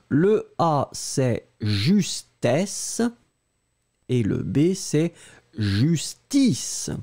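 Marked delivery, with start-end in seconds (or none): 0.9-3.0 justesse drawn out very slowly, open "è" before "-sse"; slow model pronunciation. Shown as a demonstration. Teacher contrasting justesse with justice, slowly. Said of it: "Allez, on prononce les deux". 4.7-6.9 justice said clearly, with a closed "i" before "-ce"; model pronunciation. Shown as a demonstration. Teacher contrasting justice with justesse. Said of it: "Allez, on prononce les deux".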